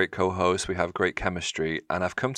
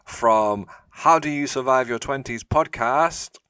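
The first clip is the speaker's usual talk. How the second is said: slow, louder, grand show-host announcement; announcer intro; 5 semitones higher, 7 dB louder, slower than usual. Acted. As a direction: drag out the guests' names like a game show host. Host introducing podcast guests.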